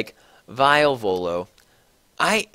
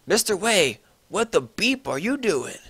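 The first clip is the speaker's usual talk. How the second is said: shouted angrily; 5 semitones higher than usual. A narrator's reading. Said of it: "Rumbridge shouted angrily".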